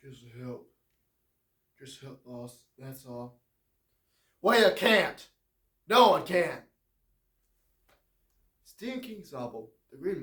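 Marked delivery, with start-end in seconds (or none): 0.0-3.4 low and soft, a gentle giant pleading; soft low John Coffey. Acted. Gentle giant asks the boss to let him help, low and soft. 4.4-6.7 louder, sharper guard voice; firm guard. Acted. Guard tells John nobody can help, firm and loud.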